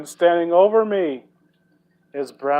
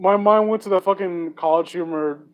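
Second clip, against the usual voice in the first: usual speaking voice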